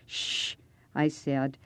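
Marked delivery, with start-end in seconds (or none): 0.1-0.6 a breathy warning shh; hushing whisper. Acted. Boy hushing his friend on the porch, whispered shh. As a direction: hush him before he's heard.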